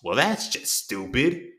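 very slow, drawn-out sneer; drawn-out condescending sneer. Retold or imitated. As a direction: make them feel small with it. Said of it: "say it in such a way".

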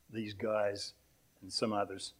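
ordinary talk, nothing marked

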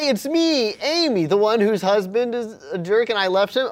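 high, bright-eyed chirp; cheery Amy voice. Retold or imitated. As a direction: burst in cheerfully. Acting out Amy bursting in.

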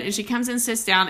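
ordinary talk

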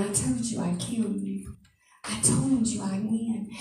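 low, soft hissing taunt; a hint of Satan taunting. Preacher voicing Satan gloating beside Jesus, low taunt. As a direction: sneer it quietly like a tempter.

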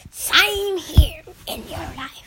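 breathy whisper of the line; imitating a sinister whisper; whispered mock threat. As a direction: whisper it like a creepy deal. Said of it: "repeating the game voice line".

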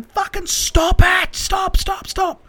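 very high, loud imitated scream; frantic acted screaming. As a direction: shriek it like someone being attacked. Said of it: "She's screaming".

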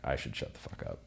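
whispered, scolding himself under his breath; muttered aside. Real, not acted. Podcaster stops himself from spoiling the book, whispering. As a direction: hush it like a self-rebuke.

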